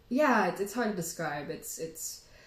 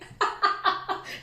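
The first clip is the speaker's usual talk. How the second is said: high breathy giggle; giddy laugh; 12 semitones higher than usual. Real, not acted. Laughing at herself after retelling the hand-smelling story.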